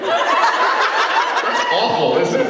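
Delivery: hammed-up Minnesota accent, loud and high; folksy Minnesota teasing, laughing. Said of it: "Can you try your best Minnesota accent".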